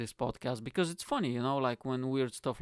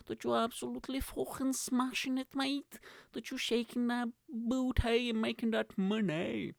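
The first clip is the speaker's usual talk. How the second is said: high hype voice; 10 semitones higher than usual. Acted. Hyped high-voiced well-wishing.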